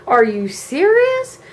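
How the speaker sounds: high, incredulous retold protest; acted-out indignant astonishment; outraged disbelief. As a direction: stretch it out, rising in disbelief.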